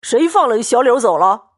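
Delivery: put-on drunken shout across the gate; rowdy yelling. A narrator's reading. Drunk Xue Pan yells at the servants at the gate, rowdy shout. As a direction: bellow it out, demanding. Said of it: "乱嚷乱叫".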